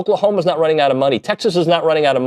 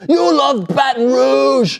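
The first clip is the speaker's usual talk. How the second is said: mocking Southern accent; 11 semitones higher, much slower than usual. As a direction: stretch Baton Rouge out, holding the R. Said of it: "And they say it like that. They hold the R".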